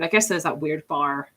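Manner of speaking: normal talking voice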